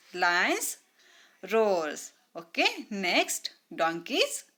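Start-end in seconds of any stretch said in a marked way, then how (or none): none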